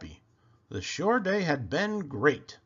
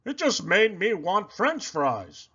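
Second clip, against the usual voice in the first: Poppleton voice; 6 semitones higher, slower than usual. A narrator's reading. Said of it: "said Poppleton".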